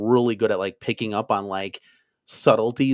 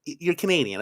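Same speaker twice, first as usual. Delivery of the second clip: normal talking voice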